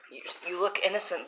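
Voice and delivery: normal talking voice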